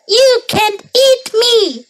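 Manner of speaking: high, slow defiant cry. A narrator's reading. Gingerbread man defies the old couple, high drawn-out cry. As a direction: call it out in a high character voice. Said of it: "he cried".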